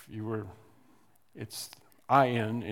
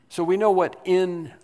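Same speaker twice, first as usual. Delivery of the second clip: "in" said higher and louder than the words round it; stress on IN; 6 semitones higher, 7 dB louder than usual. Shown as a demonstration. Preacher driving home the meaning of "in". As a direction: push up on "in". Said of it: "stressing the word in".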